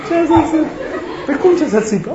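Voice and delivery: high, loud mock exchange; baffled yell. Boy and doctors misunderstanding each other, mock baffled yell.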